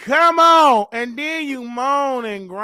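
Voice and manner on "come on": ordinary talk, nothing marked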